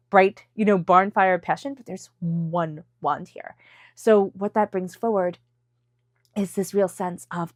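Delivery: ordinary talk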